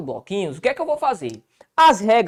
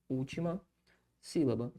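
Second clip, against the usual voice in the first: very soft, halting self-dictation; writing-along murmur; 6 semitones lower, 13 dB quieter than usual. Real, not acted. Teacher writing out the rule, soft absent murmur. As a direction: echo it quietly as you write.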